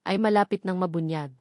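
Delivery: usual speaking voice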